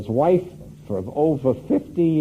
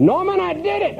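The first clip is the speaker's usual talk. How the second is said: much higher, triumphant cry; all excited. Retold or imitated. Woody phoning Norman with his news, excited. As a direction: burst out with the big news. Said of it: "all excited".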